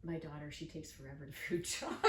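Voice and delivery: ordinary talk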